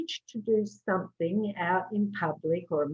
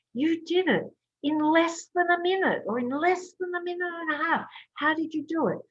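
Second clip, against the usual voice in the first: enthusiastic praise; 9 semitones higher than usual. Shown as a demonstration. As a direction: praise the client warmly and excitedly. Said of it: "And I say".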